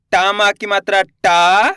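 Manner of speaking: slow spelling-out, final टा held long; drawn-out vowel. Shown as a demonstration. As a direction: stretch the aa of टा. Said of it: "टा का उच्चारण खींच के करेंगे". (टा को खींचकर बोलना)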